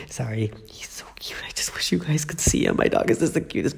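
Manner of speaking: whispering so the dog sleeps; hushed whisper; talking softly. Real, not acted. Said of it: "that's also why I'm talking really softly".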